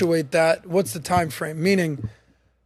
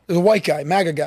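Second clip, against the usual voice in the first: ordinary talk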